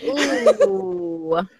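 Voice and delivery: high, drawn-out wary "ooh"; hesitant ooh. Retold or imitated. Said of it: "I'm even more hesitant".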